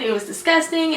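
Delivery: ordinary talk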